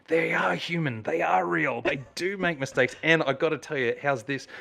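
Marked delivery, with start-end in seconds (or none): 0.1-3.0 higher, marvelling at the band; mock revelation. Watching the band's drummer apologise, in mock wonder. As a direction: act amazed that stars are human. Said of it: "And I'm like".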